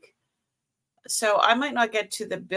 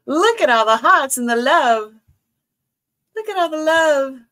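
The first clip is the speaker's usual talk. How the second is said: delighted gush; 6 semitones higher, 7 dB louder than usual. Real, not acted. Delighted at chat hearts.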